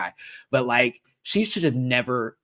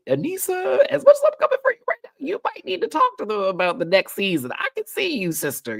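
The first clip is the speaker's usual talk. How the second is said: very high, loud excited call to Anissa; fast, squealing shout-out to a friend; 4 dB louder than usual. Real, not acted. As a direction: shriek her name like a fan calling across the room. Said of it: "calling out to Anissa".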